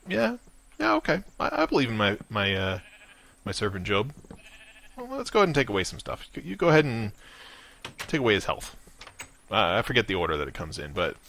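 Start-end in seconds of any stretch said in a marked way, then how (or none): 0.1-8.7 casual God, higher and quick; breezy, offhand God agreeing to a bet; voicing God. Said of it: "And God's like".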